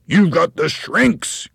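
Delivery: high, slow, loud pronouncement; loud cry. A narrator's reading. Husband accusing his wife like a pistol shot, loud cry. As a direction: cry it out, drawing out each word. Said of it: "cried Mister Twit".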